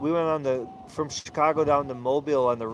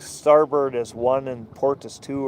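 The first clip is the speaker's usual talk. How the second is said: normal talking voice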